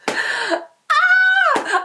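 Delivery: high, loud sudden yelp; startled cry. Real, not acted. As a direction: yelp out in shock. Sudden shock at the memory.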